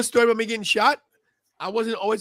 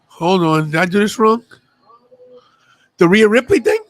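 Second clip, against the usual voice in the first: loud confusion; 11 dB louder than usual. Real, not acted. Confused about the wrong link.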